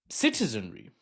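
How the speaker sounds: normal talking voice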